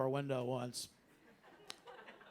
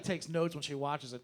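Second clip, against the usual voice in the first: ordinary talk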